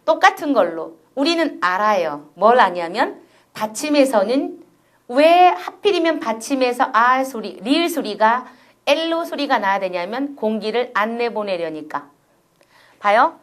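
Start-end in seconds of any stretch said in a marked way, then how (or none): none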